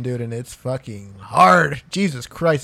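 ordinary talk

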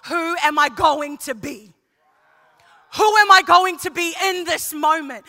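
high, loud voice of a man searching himself; Joseph's inner cry. Retold or imitated. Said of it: "the epitome of".

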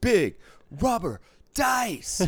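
high, loud, drawn-out mock announcement; booming high showman call, slowed right down; mock big announcer voice. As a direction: stretch it out like a goofy showman.

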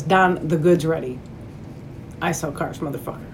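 tough-guy voice. Retold or imitated. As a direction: read the card like a menacing hustler. Reads business card in tough voice.

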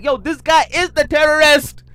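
high, loud, outraged call; excited shout. Retold or imitated. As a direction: yell it like a tip-off. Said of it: "I'm like".

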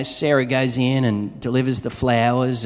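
ordinary delivery

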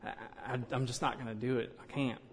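a quiet, defeated inner monologue; inner reluctance. Retold or imitated. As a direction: murmur it like you're talking yourself out of it. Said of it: "there's something in it that's".

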